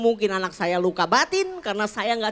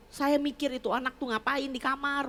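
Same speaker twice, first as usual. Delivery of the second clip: ordinary talk, nothing marked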